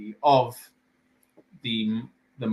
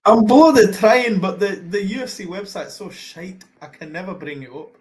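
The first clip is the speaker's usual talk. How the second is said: high, exasperated burst, starting loud; frustrated outburst, easing off in volume; exasperated protest; 7 semitones higher, 8 dB louder than usual. Real, not acted. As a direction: protest that you really are trying. Co-host snaps back when hurried.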